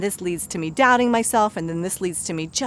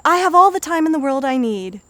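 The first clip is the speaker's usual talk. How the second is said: higher, louder forced cheer; over-the-top positive voice; 6 semitones higher, 5 dB louder than usual. Shown as a demonstration. Coach mocking a forced affirmation, higher louder voice. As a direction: overdo the affirmation until it sounds fake. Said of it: "instead of going".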